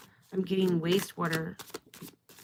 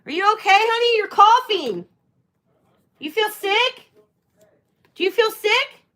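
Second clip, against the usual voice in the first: high, louder concerned voice for the little one; 13 semitones higher, 8 dB louder than usual. Real, not acted. Mother asking her coughing child if she feels sick, high.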